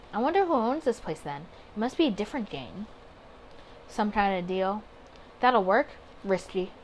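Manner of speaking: ordinary talk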